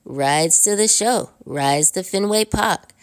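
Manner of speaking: bad Boston hawker's singsong; horrible Boston accent. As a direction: imitate a Boston pedicab driver calling out for fares. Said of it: "that's a horrible Boston accent".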